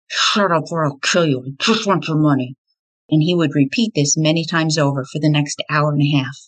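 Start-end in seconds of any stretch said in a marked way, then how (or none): 0.1-2.6 muffled threat through clenched teeth. A narrator's reading. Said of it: "Through clenched teeth, a muffled voice commanded".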